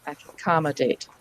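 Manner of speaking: "Accommodate" blended into one word, ac-com-mo-date. Shown as a demonstration. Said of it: "then you just say it faster".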